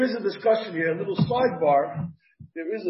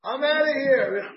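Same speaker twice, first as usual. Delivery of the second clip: higher, drawn-out exasperated outburst; angry wife; 5 semitones higher, slower than usual. Acted. Wife storming out on her husband, mock angry. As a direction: act out a fed-up wife, slowly and higher. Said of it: "When they get angry".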